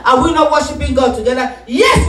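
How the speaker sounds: normal talking voice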